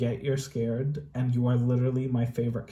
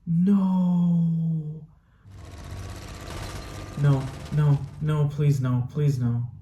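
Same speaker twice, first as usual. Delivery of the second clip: anguished denial; 5 semitones higher, much slower than usual. Real, not acted. Watching war scene, pleading.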